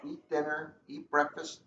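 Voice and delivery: usual speaking voice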